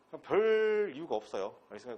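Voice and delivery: ordinary talk, nothing marked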